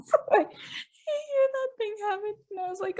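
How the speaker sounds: high, laughing through the words; laughing while telling. Real, not acted. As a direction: giggle as you tell it.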